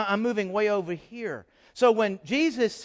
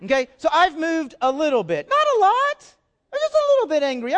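squeaky mock anger; 9 semitones higher, 7 dB louder than usual. Shown as a demonstration. Preacher acts out slight anger.

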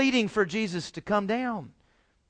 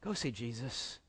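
soft urging; 10 semitones lower, 15 dB quieter than usual. Shown as a demonstration. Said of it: "you need to tell people".

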